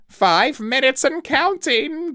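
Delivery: high, loud, slow strident call; strident Oak Elf. A narrator's reading. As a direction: announce the countdown like a shrill sentry. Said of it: "strident voice denounced".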